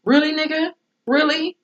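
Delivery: high, disbelieving repeat of really; voicing the wife's outraged disbelief; incredulous. Wife incredulous at her cheating husband, high and disbelieving.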